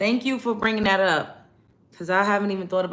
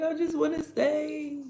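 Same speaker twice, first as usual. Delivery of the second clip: put-on whiny kid plea; 10 semitones higher, slower than usual. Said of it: "I used to be like".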